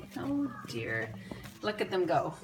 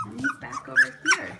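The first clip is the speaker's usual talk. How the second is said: laughing; 6 dB louder than usual. Real, not acted. Laughing hard at puppy.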